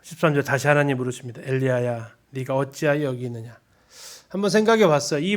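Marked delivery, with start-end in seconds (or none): 1.4-3.6 softer, gentle coaxing voice; 다독이는 하나님의 목소리; soothing God. Acted. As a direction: coax him out like a comforting father. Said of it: "일 번 다독이는 목소리".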